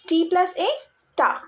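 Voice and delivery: "ta" said as one joined syllable, T running into A; liaison sound ta. Shown as a demonstration. Teacher showing students how T and A join as ta. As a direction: blend T and A into "ta" for the class. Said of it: "The sound of T and A together".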